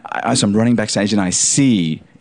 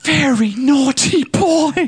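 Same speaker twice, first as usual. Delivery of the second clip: high, slow scolding English lady; mock-scold like a prim governess; English accent; much slower than usual. Hayley Mills scolding him.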